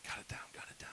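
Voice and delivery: soft, urgent whispered command; hushed whisper. Retold or imitated. Leader quiets worshippers in hiding, urgent whisper. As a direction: hush the group under your breath. Retold hushing of hidden worshippers.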